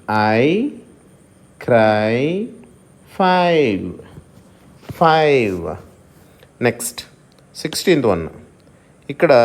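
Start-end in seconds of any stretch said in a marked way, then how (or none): 0.1-5.8 "క్రై, ఫై" gliding a to i; ai diphthong demo. Shown as a demonstration. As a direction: stretch each word, sliding from a to i. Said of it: "వీటి pronunciation ఈ విధంగా ఉంటుంది". ("క్రై, ఫై" ను ఆ నుండి ఈ కి జారుతూ పలకండి)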